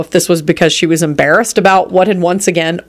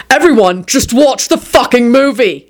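exasperated higher-pitched order; yelled; 6 semitones higher than usual. A narrator's reading. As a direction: yell at the whole room. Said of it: "yelled Quentin".

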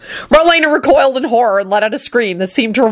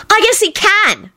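put-on high, slow triumphant girl; triumphant call; 14 semitones higher, slower than usual. A narrator's reading. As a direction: crow it out. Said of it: "called out triumphantly".

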